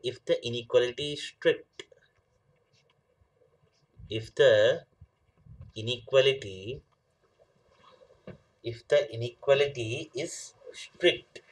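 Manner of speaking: slow dictation with long pauses. Real, not acted. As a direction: dictate it slowly, phrase by phrase. Dictates definition while writing. (धीरे-धीरे लिखवाते हुए)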